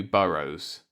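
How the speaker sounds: ordinary delivery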